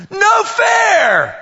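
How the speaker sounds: mock child shouting no fair; whiny kid protest. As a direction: whine it like a cheated little girl. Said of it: "she'll say something like".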